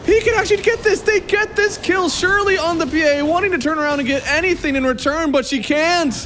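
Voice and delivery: very high, excited esports caster; real high-pitched commentator excitement; excited play-by-play. Real, not acted. Live play-by-play, fight climax.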